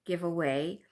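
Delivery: ordinary talk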